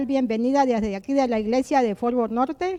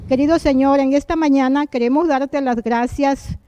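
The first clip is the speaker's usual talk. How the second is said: fervent spoken prayer, a bit louder; earnest prayer; 5 dB louder than usual. Acted. Host leads the listeners in prayer, earnest prayer voice. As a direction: pray aloud on behalf of everyone.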